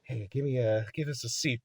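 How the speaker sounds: low, soft, offhand request; imitating a smug, quiet order; cool retold demand. Voices cocky Peter at a club.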